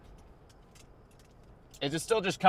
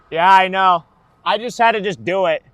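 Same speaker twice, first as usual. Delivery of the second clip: loud; 4 semitones higher, 9 dB louder than usual. Real, not acted. Defensive reply to chat.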